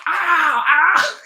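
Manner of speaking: high, loud yelps of pain. Retold or imitated. Director hit on the foot by falling stands, yelping in pain. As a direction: yelp as if light stands just hit your foot. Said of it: "he's like".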